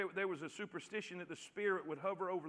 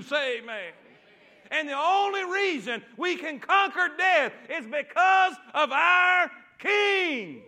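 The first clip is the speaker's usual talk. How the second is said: loud, raised pulpit cry with stretched key words; full-force preaching; 5 semitones higher, 10 dB louder, slower than usual. Real, not acted. Preacher rallying the congregation over death's defeat, full-force preaching. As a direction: build to a shouted, triumphant climax.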